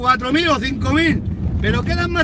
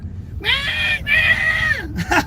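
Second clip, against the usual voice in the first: real burst of laughter, much higher; shrieking laugh. Real, not acted. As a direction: laugh out high at the crying patriots. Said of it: "¡Güey, tío, qué risa!". (carcajada aguda y real)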